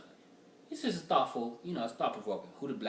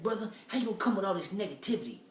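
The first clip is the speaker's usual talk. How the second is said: higher, loud mock scolding dude; annoyed Facebook guy; 8 semitones higher, 4 dB louder, faster than usual. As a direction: imitate a preachy guy scolding him. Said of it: "He said".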